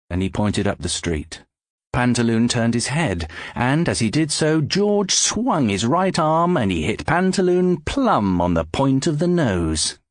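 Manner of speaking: ordinary delivery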